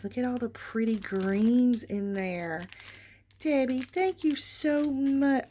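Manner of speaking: ordinary delivery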